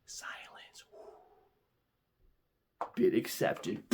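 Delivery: soft, drawn-out whisper; hushed suspense. Acted. Storyteller after the bid is placed, hushed whisper.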